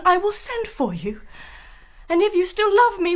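ordinary talk

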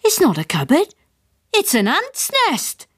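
high, alarmed dad voice; the father finds an ant's nest. A narrator's reading. Father, alarmed and high-pitched.

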